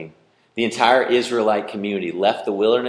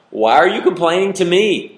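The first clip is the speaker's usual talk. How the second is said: high, loud, fed-up "to me"; exasperated Moses; 7 semitones higher, 6 dB louder than usual. Acted. Moses snapping back at the grumbling Israelites, high and loud. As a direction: throw up your hands as Moses. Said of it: "I love Moses' response".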